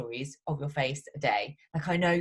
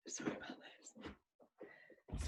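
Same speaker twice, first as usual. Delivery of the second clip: whispering sorry to the call; breathy whispered aside; whispered apology; 22 dB quieter, much slower than usual. Real, not acted.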